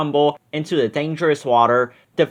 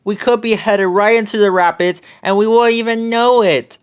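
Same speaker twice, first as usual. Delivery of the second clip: higher and louder alarm; alarmed exclaim; 6 semitones higher, 4 dB louder than usual. A narrator's reading. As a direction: warn the gang urgently. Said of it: "Velma exclaimed".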